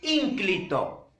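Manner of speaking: Ínclito said slowly, each syllable clear; a lofty, deliberate Ínclito; slow formal word. Shown as a demonstration. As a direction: lay out Ínclito slowly and carefully. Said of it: "una palabra en el superestándar".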